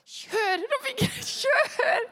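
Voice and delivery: high loud shout laughing; panicked shout. Retold or imitated. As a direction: yell at the driver to floor it. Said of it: "Og vi bare". (ropt til sjåføren Rolf Inge, høyt og leende)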